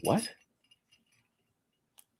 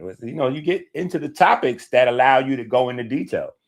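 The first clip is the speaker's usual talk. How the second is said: ordinary delivery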